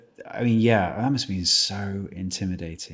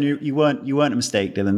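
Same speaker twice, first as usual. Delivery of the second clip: normal talking voice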